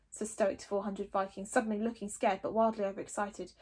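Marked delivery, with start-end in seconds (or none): none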